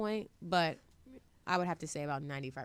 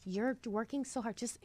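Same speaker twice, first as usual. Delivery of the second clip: mother's pleading whisper; 5 semitones higher, 4 dB quieter, slower than usual. Retold or imitated. Parents begging her to quit.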